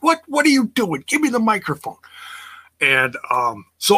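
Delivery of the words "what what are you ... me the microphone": high, indignant mock outburst, voiced much higher; mock angry Taz. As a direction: imitate an angry wrestler grabbing for the mic. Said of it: "He's like".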